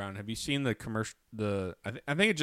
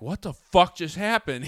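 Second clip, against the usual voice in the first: irate crowd; 8 semitones higher, 7 dB louder than usual. Retold or imitated. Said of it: "people were irate 'cause they're like".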